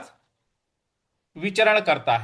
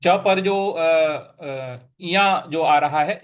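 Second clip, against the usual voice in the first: ordinary talk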